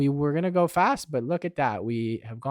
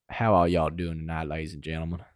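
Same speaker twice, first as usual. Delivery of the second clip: smooth country star; 9 semitones lower, faster than usual. Pretend. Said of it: "lean into that mic real smooth".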